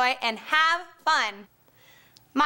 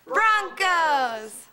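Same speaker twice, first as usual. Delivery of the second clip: shouted team name; cheer reply; 3 semitones higher than usual. Acted. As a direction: answer the cheer, drawing out Broncos. Answering the call-and-response cheer.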